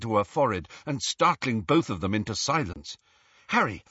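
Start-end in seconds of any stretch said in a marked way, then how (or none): 3.5-3.8 higher, urgent call of the name; impatient. A narrator's reading. Hermione wanting the locket from Harry, impatient. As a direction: demand his attention at once. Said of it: "she said impatiently".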